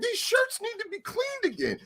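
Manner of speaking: high, soft put-on complaining voice; mimicking Kevin. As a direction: whine like a prissy boss. Kevin voiced in retold story.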